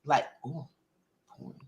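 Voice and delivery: hushed unvoiced whisper. Real, not acted. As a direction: whisper it like a secret. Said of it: "why are you whispering".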